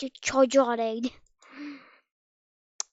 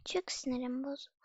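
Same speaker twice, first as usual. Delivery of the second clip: unvoiced, much softer grumble; annoyed whisper; 8 dB quieter than usual. Real, not acted. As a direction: whisper your frustration. Whispered annoyance after falling. (sinirli fısıltı)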